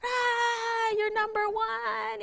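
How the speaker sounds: high, sarcastic yell at a driver; hammed-up angry driver retort; mock road rage. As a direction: fling a mock insult at the car ahead. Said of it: "you could have easily been like".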